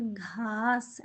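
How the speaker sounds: drawn-out demo word. Shown as a demonstration. Teacher to pupils, drawing out the Hindi word. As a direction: stretch ഘാസ് out very slowly, softly. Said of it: "പുല്ലിന് എന്താണ് ഹിന്ദി പറയുന്നത്?".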